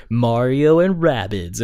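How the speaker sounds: loud, slow mock stage announcement; imitating a presenter's unveiling; announcer reveal. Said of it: "walked out there and went".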